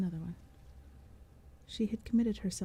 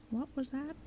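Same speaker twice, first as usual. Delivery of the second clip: fussy door knocker; 7 semitones higher than usual. Acted. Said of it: "the first knocker inquired".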